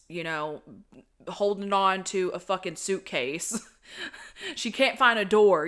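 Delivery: ordinary delivery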